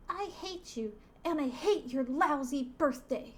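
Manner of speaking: high, put-on child's voice; little boy voice. Boy telling off the devil, high little kid voice. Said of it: "And the little boy says".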